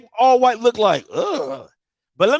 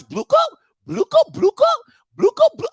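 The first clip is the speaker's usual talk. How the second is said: higher chirpy imitation of a game jingle; mimicking game sounds; 10 semitones higher than usual. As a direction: tease it like a phone game's looping sound. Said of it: "she playing Candy Crush".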